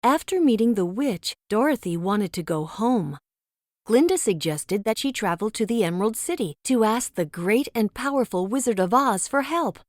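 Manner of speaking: usual speaking voice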